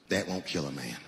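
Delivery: very low, matter-of-fact clerk voice; gun store clerk. Retold or imitated. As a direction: drop deep, like an unhurried gun seller. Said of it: "he goes just like this".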